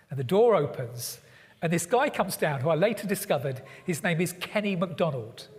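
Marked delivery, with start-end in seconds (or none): none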